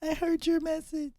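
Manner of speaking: high, soft imitation of a crying fan; mock tearful fan. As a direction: squeak it out like an emotional churchgoer. Voicing people at the shower.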